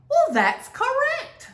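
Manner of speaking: high, bright praise for a right answer; pleased teacher praise. A narrator's reading. Teacher praising Grace for her answer, smiling. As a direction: reward the answer with a smile. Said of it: "Missus Barrington smiled".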